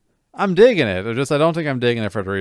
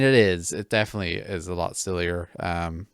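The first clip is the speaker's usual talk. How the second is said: ordinary delivery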